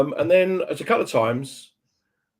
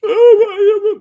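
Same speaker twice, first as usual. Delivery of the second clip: long, high, loud groaning oh; 13 dB louder than usual. Real, not acted. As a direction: let out a big pained oh. Groan at awkward memory.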